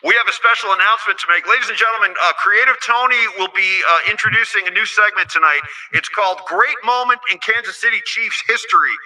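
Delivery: loud, booming stage introduction; announcer voice. Shown as a demonstration. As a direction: announce it to a packed arena. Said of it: "let me get my announcer voice here".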